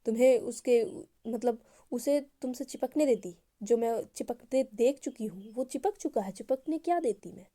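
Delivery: loud, heated jealous outburst; angry. A narrator's reading. Eva angrily defends herself to Anu about the clingy guy, loudly. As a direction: fire back, raising the voice. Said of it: "थोड़ा सा गुस्से में".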